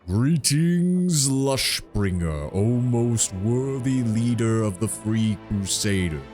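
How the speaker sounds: slow, solemn tree giant; treant voice. Acted. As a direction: draw each word out like an ancient tree.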